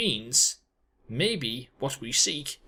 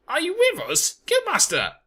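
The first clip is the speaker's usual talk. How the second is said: much higher, asking; high coaxing; 13 semitones higher, 4 dB louder than usual. Acted. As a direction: nudge a dozing boss. Tries to wake guildmaster.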